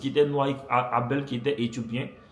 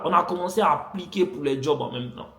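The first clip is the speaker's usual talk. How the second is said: ordinary talk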